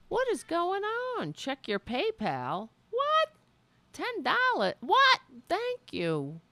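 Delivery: high, squeaky disbelief; astonished. Real, not acted. Streamer reacts to a surprise ten dollar donation, high astonished squeaks. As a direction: shriek with happy shock.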